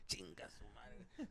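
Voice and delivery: slow, breathy whispered curse. Retold or imitated. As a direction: mutter it under your breath. Dad cursing under breath.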